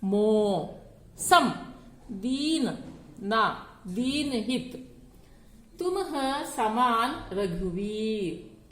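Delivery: drawn-out, measured recital of the doha, each syllable weighed; slow couplet recitation. Shown as a demonstration. Teacher reciting a doha slowly to students. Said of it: "तो देखिए".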